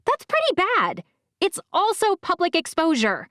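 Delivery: put-on girl scolding her brother. A narrator's reading. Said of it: "Bee said".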